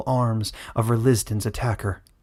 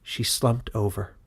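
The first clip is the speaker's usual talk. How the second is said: usual speaking voice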